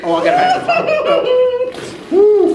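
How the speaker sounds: very high whinnying squeal; horse whinny voice. Shown as a demonstration. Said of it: "the horse warm-up".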